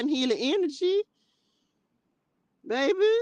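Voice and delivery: high, laughing church-lady chorus; congregation voice. Retold or imitated. Host imitating the congregation answering a troll, high mock church voice. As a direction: voice the whole church reacting. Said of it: "the whole congregation say".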